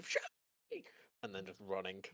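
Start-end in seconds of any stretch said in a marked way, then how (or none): 0.0-0.8 very high squeal at everyday volume; mock scream. Game master voicing Adonis's scream for the players, mock scream. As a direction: imitate a distant shriek without real force. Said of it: "all of you do hear the scream".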